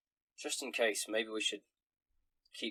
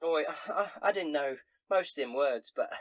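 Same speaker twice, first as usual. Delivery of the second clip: high, loud, giggly barmaid; tipsy barmaid voice; 4 semitones higher, 8 dB louder than usual. Acted. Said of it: "and she says".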